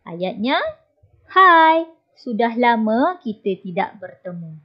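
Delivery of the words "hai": higher, louder hai; calling hai. Shown as a demonstration. Teacher reading an example greeting aloud. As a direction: call it out brightly. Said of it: "Yang pertama, hai".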